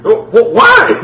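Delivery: high, loud stammered wail; mock dismay. Child told he'll get a spanking, hammed-up panicked protest.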